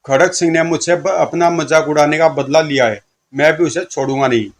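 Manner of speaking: usual speaking voice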